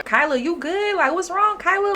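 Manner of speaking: concerned check-in. Retold or imitated. Checking on panicking Kyla.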